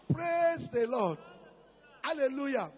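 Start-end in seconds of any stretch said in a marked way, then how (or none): none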